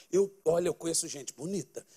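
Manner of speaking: usual speaking voice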